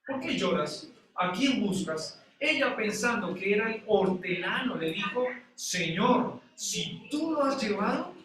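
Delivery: preacher declaiming scripture with drawn-out phrases; scripture reading. Acted. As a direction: read the verse out deliberately, stretching the key phrases. Reads the verse aloud to congregation.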